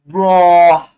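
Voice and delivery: ordinary talk, nothing marked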